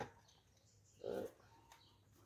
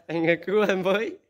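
mock begging; 2 semitones higher, 5 dB louder than usual. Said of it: "Về phát".

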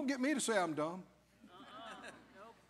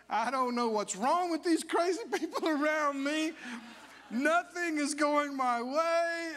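laughing mock complaint; 4 semitones higher than usual. Mock complaint of churchgoer by Thursday.

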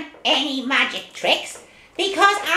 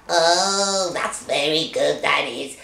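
low, gloating witch voice; smug witch croon; 13 semitones lower, slower than usual. Acted. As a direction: savour it like a wicked witch. Witch cackling over her spell.

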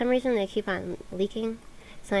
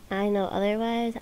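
ordinary talk, nothing marked

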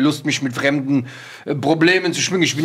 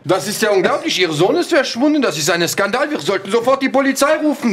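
outraged alarm; 7 semitones higher than usual. Acted. Doctor outraged at missing son.

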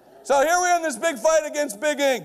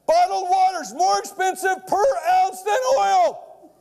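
slow shouted reveal; 7 semitones higher, slower than usual. Real, not acted. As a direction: hammer the fact home to the crowd. Punchline of the build-up.

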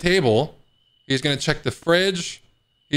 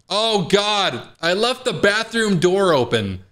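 high-pitched dismay, drawn-out oh; alarmed; 6 semitones higher, slower than usual. Acted. Player spotting a fatal mistake in the game, alarmed. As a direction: cry out in mild panic at a blunder. Realizes door left open as intruder enters.

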